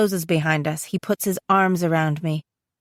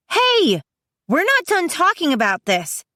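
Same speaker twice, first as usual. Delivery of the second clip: high, insistent call; calling after him; 10 semitones higher than usual. A narrator's reading. Sister to brother turning to go, calling after him. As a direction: stop him with a high, drawn "Hey".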